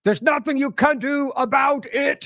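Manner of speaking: slow, high, mocking echo of a fake menacing voice; mocking imitation. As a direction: parrot the line like a cartoon villain. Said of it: "Who talks like that?".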